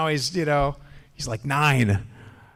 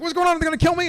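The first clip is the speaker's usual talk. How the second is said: scared dog voice; much faster than usual. Retold or imitated. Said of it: "He's like".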